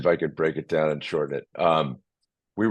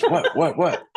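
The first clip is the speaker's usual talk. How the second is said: high, eager, laughing questions; excited what; 11 semitones higher, 4 dB louder, slower than usual. Retold or imitated. Wife hurrying her agent for news, eager and laughing. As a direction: press for the news, half laughing.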